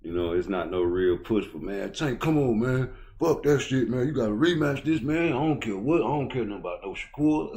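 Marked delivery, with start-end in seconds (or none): 1.6-7.6 higher and louder, egging Tank on like a hyped fan; fans pleading excitedly. Retold or imitated. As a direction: push him hard, rising into a shout. Fans urging Tank to rematch.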